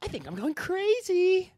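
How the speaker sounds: high, slow unhinged psychopath voice; creepy high-pitched madman, drawn out; psychopath impression. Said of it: "can we each do our best psychopath impression".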